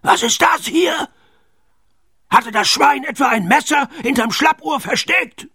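very high, squawking captain's outburst; angry pirate captain. A narrator's reading. Pirate captain discovers the pig's trick, shrill outrage.

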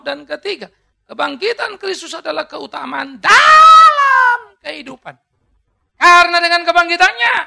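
loud, high preaching, shouted to the church; fiery preaching. Acted. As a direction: preach it at full force, voice cracking. Preaching at full force. (khotbah berapi-api, suara tinggi dan keras)

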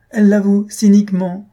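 high, loud indignant exclamation; outraged cry. A narrator's reading. Husband denounces the girl to his wife, loud outrage. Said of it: "s'écria Maroquin".

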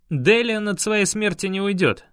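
usual speaking voice

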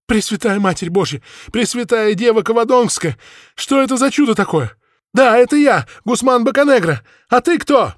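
high, loud, amazed cries; astonished shouting. Acted. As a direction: shout back in disbelief. Prisoner amazed shouting back.

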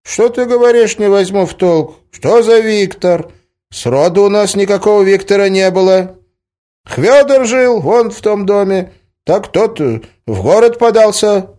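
put-on very high, loud old peasant voice; old villager. A narrator's reading. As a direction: holler back as a baffled village grandpa. Old villager again, voiced by narrator.

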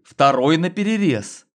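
plain reading voice

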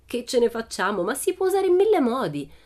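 confident retort, leaping up on the answer; dismissive echo. A narrator's reading. Pippi answering Tommy's doubt about its uses, dismissive. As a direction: throw the question back, then rise high and loud.

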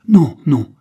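loud, emphatic protest; exclaimed denial. A narrator's reading. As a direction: shout the denial out at once. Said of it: "clamèrent-ils en même temps".

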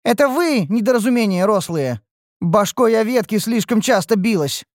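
high, loud catgirl retort; furious, high-pitched scolding; enraged. A narrator's reading. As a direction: hurl the insults back in a high voice. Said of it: "взъярилась Рен".

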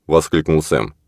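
ordinary delivery

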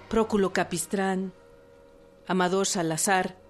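normal talking voice

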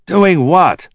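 high, loud, demanding question. A narrator's reading. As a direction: demand an answer at once. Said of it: "Liebman demanded".

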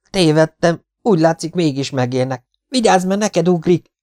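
high, loud, urgent retraction; alarmed warning. A narrator's reading. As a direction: blurt it out in a frightened high voice.

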